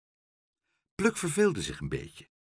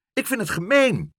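high, loud indignant protest; angry outcry; 11 semitones higher, 6 dB louder than usual. A narrator's reading. Pluk forgets his fear of mevrouw Helderder, angry outcry. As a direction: burst out in anger. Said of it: "werd vuurrood van boosheid".

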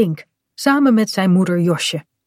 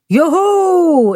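pirate call; 10 semitones higher, 5 dB louder than usual. Acted. Pirate captain greeting.

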